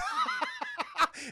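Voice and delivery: high run of acted laughter; imitated laughing. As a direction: laugh at someone else's scare. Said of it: "Batman starts laughing".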